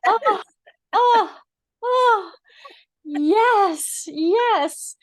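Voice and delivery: high, drawn-out oohs rising into approval; delighted reaction. Real, not acted. Listener relishing a friend's staircase flirting story, delighted. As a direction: savour each oh and yes, high and loud.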